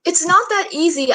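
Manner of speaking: understated scream at mom; mild acted scream. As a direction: protest in frustration, short of a real scream. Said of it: "ice cream".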